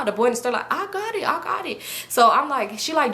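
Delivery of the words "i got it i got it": high-pitched imitated bystander; hurried reassurance. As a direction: mimic someone quickly stepping in, voice pitched up. Boy reassures store guy.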